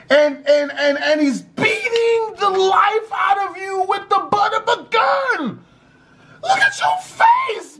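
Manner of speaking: high, shouting, furious disbelief; infuriated rant. Real, not acted. Narrator raging at the beaten girl for defending him. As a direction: yell it like you can't believe her. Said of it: "It infuriated me".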